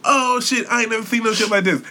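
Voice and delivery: high-pitched mock amazement; impressed date. Man voices the imagined date reacting, amazed. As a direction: act out an awestruck date, voice way up.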